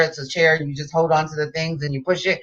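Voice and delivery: usual speaking voice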